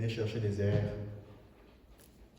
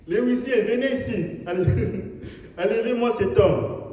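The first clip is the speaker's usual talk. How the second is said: loud, high call to the ushers; a mock order shouted across the hall; 10 semitones higher, 8 dB louder than usual. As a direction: summon the ushers from the pulpit. Calling ushers to remove man.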